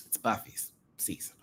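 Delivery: softly whispered quip; hushed teasing aside. Real, not acted. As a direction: tell it as a secret.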